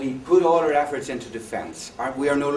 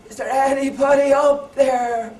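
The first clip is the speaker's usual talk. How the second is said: high, loud, slow call upward; desperate shout; 12 semitones higher, 9 dB louder than usual. Retold or imitated. Man still hanging from branch shouts up, slow loud yell. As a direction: drag out the plea, yelling skyward. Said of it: "and he shouts".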